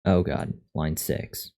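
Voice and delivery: ordinary delivery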